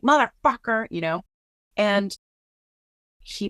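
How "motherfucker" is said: higher-pitched mock-combative curse; imitated fighting outburst. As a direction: act out a brawler's curse, lightly. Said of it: "fighting. I'm not like".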